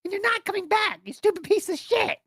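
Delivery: very high squeaky tirade; mock banning rant. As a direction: gleefully rant in a tiny high voice. Acted mock ban rant by streamer.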